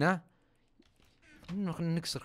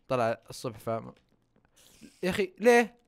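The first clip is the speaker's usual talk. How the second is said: ordinary delivery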